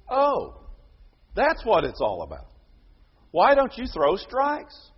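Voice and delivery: high, loud mocking echo of the advice; the pitcher throwing the manager's advice back at him; sarcastic. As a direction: repeat it back with biting sarcasm. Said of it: "As if he hasn't been trying".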